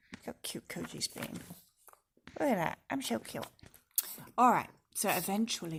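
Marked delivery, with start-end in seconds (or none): 0.1-1.4 soft cooing aside; hushed aside. Real, not acted. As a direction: coo it under your breath. Aside about puppy.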